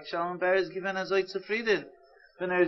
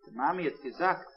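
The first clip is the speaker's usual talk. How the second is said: usual speaking voice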